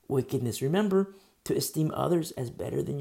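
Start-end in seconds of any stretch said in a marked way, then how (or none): none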